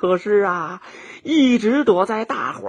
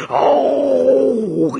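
long, high mock tiger roar; drawn-out roar of an angry beast; 4 semitones higher than usual. Tiger outraged at losing first place, roaring. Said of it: "气得他大声地吼了起来".

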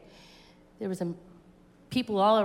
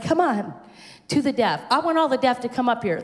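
loud, higher-pitched altar call; calling people forward; 4 semitones higher, 4 dB louder than usual. Retold or imitated. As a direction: summon the crowd up front. Reenacting call to deaf people.

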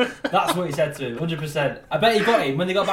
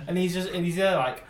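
ordinary delivery

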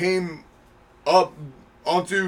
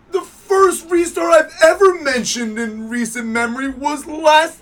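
raised, high-pitched defensive outburst; indignant protest; 6 semitones higher, 4 dB louder than usual. Real, not acted. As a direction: protest loudly against the teasing accusation. Protesting a teasing accusation.

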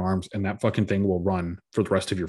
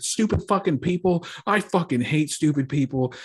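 retold rage rant; 5 semitones higher than usual. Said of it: "caught my fucking rage rant".

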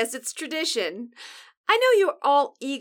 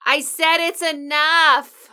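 loud, slow and higher; irritated yell; 4 semitones higher, 7 dB louder, slower than usual. Acted. Co-host repeating her order to stop, irritated. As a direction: spell out that you are fed up. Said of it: "the whole thing is very irritating".

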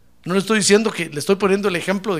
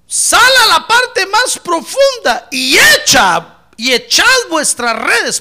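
slow, high, booming scripture command; loud proclamation; 9 semitones higher, 10 dB louder, slower than usual. Acted. Jesus commanding Simon to let down the nets, loud proclamation. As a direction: proclaim it forcefully, drawing out the words.

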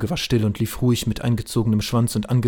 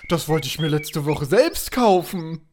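ordinary talk

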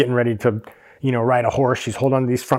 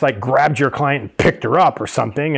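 ordinary talk

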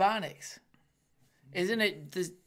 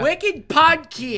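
higher, louder, slow drawled Boston voice; mock Boston; 7 semitones higher, 10 dB louder, much slower than usual. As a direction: stretch out wicked podcast like a Bostonian. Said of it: "ad read with Boston wicked".

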